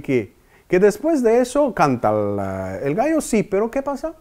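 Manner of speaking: normal talking voice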